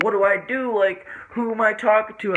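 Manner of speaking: high, loud bewildered questions; panicked confusion. Retold or imitated. Confused, seeing triple.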